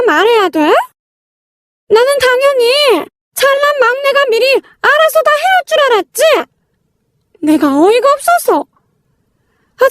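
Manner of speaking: lightly acted, irritated and domineering in-law; bossy sister-in-law, irritated. Sister-in-law cutting off the newcomer's excuses, mildly irritated scolding. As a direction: order her to obey, pitch lifting with annoyance.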